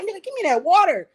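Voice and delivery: desperate thirsty demand for the water; loud demand. Retold or imitated. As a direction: snap at them to hand over the water.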